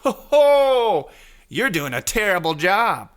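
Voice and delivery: high, loud, hammed-up jeer; mock scolding crowd voice; mocking America. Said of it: "They're just like".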